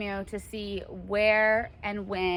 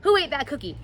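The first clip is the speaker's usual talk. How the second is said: accusing; 6 semitones higher, 4 dB louder, faster than usual. Pretend. Accuser in cookie example.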